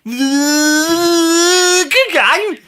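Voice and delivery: very high, long wind-up into the phrase; squealed catchphrase. Pretend. Answering the co-host's game, squealed catchphrase.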